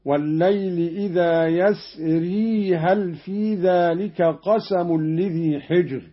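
يسري recited "yasri" with the final ya sounded; ya kept. Shown as a demonstration. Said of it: "أثبت قالون ياء يسر وصلا".

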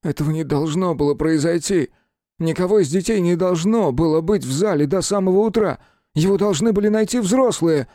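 high, anguished insistence; distraught protest. A narrator's reading. Killer horrified a child found the body, distraught. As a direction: build from dismay to a high outcry.

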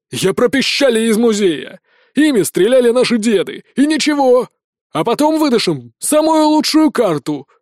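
loud, high irate orders; angry colonel. Acted. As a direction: override the assistant with rising pitch. Furious colonel keeps dressing down assistant. (сердитый громкий приказ)

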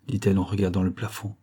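usual speaking voice

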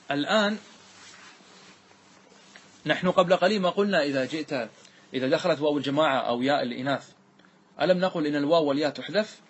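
ordinary talk, nothing marked

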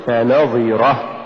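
فنظرة with ف set apart and نظرة pushed; push after fa. Shown as a demonstration. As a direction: lean on the ن in فنظرة. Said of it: "قل". (نطق فنظرة بدفع النون بعد الفاء)

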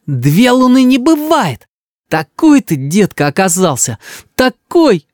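put-on high, indignant child voice; betrayed little boy. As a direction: reproach grandpa like a wounded kid. Boy on verge of tears accuses.

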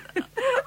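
brief high laugh; a quick amused laugh; short laugh. Acted. Laugh after praising acting.

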